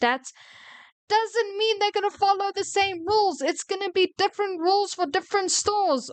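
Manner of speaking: high-pitched, indignant rant; angry shouting. Real, not acted. Ranting at coworkers about store policies, shouting angrily. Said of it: "why I'm shouting".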